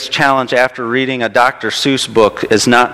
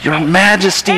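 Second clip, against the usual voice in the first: higher-pitched, mildly pained plea; lightly groaning turtle; 6 semitones higher than usual. A narrator's reading. Little turtle calling up to his king, mild groan. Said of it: "came a groan from that plain little turtle".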